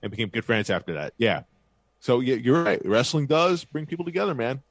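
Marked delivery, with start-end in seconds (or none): none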